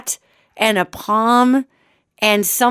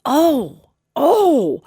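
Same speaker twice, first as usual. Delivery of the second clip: dismayed oh; 6 semitones higher than usual. Retold or imitated. Stunned at the ugly puppy.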